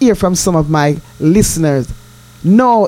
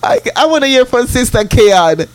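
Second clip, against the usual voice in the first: hammed-up fake sobbing, slow and whiny; mock crying; 4 semitones higher, slower than usual. To Sister Mavis who teased her, hammed-up mock crying. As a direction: pretend to weep over being let down. Mock hurt at listeners' replies.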